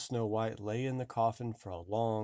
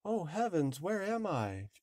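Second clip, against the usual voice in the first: high startled waking cry; 7 semitones higher than usual. Acted. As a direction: gasp it out, just awakened and lost. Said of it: "cried she".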